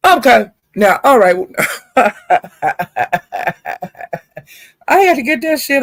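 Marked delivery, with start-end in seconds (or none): 1.4-4.4 drawn-out rolling chuckle; long laugh. Real, not acted. Speaker winding up after the rant, laughing. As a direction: laugh it off at length.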